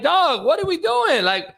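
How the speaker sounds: high, disbelieving question; incredulous. Retold or imitated. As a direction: ask it like you can't believe it. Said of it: "I'm like".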